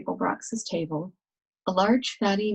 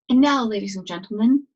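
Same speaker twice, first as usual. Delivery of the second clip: louder, grand compere announcement; beaming showman; 3 semitones higher, 7 dB louder than usual. Acted. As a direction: beam at the crowd like a host. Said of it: "he beamed".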